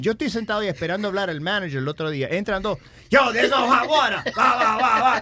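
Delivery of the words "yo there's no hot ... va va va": imitating an angry tenant, higher and louder; mock yelling. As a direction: yell like a rude tenant, lightly. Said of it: "Le está gritando".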